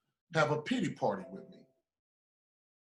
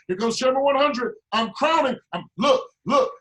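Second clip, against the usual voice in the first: high, loud declaring; preaching climax; 9 semitones higher, 6 dB louder than usual. Acted. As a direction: proclaim victory to the crowd.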